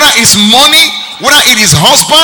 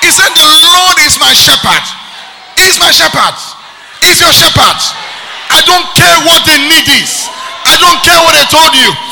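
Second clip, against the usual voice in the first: full-force preaching; 6 semitones higher than usual. Acted. Preacher declaring blessings over the congregation, full-force preaching. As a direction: proclaim it with everything you have.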